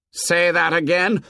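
high menacing dare; threatening. A narrator's reading. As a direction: dare him to repeat. Jack's threat.

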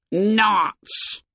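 high-pitched scornful exclamation; indignant boyish interjection; shocked outburst. A narrator's reading. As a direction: burst out with it, pitched right up. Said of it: "shocked out of decorum".

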